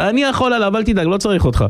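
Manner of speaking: high-pitched, dismissive bravado; a child insisting he can manage; cocky boy. Retold or imitated. Son refuses father's help against the Cossack, cocky.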